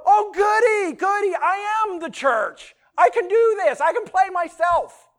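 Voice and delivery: high, loud squeal of delight; gleeful wives. Acted. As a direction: crow it like you've won the best part. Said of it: "I imagine wives go".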